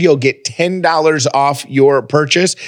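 usual speaking voice

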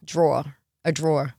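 low New York drawer, falling; New York accent. Shown as a demonstration. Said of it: "in New York, a good one".

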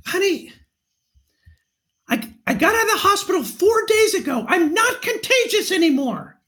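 high, strained pretend yell; mock yell. Husband pretending to argue with his wife on a trail, mock yell. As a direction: yell it high, as if mid-argument, without real volume. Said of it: "I would yell out".

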